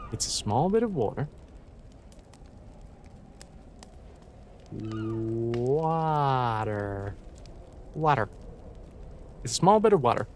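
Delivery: quiet run-through in an American accent, testing "water"; American accent practice. Shown as a demonstration. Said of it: "when it comes to American".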